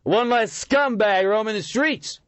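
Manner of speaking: high, loud, slow jeer; drunken yell. A narrator's reading. Drunk heckler continuing abuse.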